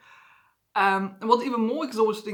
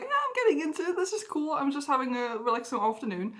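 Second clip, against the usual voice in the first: bright, high, cheerful and pleased; content, enjoying the afternoon; happy and relaxed; 5 semitones higher, 4 dB quieter, faster than usual. Retold or imitated. Recalling a happy afternoon, high and cheerful. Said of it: "I'm like".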